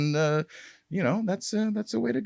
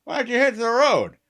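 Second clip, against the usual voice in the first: high, tense brush-off of a stranger; gruff NPC; 11 semitones higher, 4 dB louder than usual. Shown as a demonstration. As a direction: shoo the players off as a surly local. Example NPC voice for roleplay.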